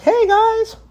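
squeaky-high goofy gargoyle greeting; funny gargoyle. Retold or imitated. Man imitates the funny fat gargoyle from the cartoon, high and goofy. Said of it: "the fat gargoyle who's, like, funny".

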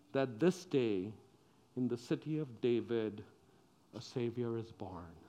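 soft, low, unhurried reassurance; reassuring angel. Acted. Angel tells scared shepherds the good news, reassuring. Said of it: "then the angel said, Don't worry".

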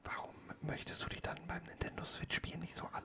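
hushed whisper. Acted. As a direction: whisper the question. Whispered teasing questions.

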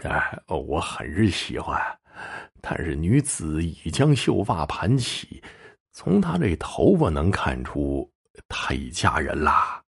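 slow, soft, regretful answer; dazed reply. A narrator's reading. Yang Jin answers his cousin about the married woman, slow and dazed. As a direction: answer slowly and quietly, as if still in a daze. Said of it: "呆滞了半天才回话道".

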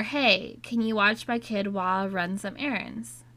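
ordinary talk

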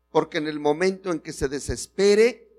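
normal talking voice

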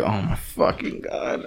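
hushed, slow disbelief; exasperated aside. Retold or imitated. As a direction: let it drop away under your breath. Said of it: "I'm like".

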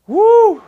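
very high, very loud woo; excited whoop. Real, not acted. Preacher overcome after saying my God, exultant whoop. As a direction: let out a piercing preacher's whoop.

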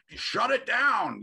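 mock protest shout, high and drawn out; outraged protesting moms. Imagining suburban moms protesting in the streets, mock outrage. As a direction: imitate moms chanting in the streets, loud and slow.

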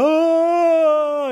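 long high comic "oh"; alarmed wail. A narrator's reading. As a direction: howl in mock alarm at the sight. Daddy alarmed by spinning top.

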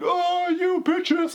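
high, drawn-out imitation through a gag; gagged man yelling. Co-host imitating the gagged man in the movie, high mock cry. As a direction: mimic a gagged victim's long, high cry. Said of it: "like, with this gag going".

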